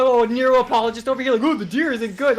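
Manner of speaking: sarcastic quoting of an opponent; mocking imitation. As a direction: parody the other player's excuse, heated. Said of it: "What are you gonna be like".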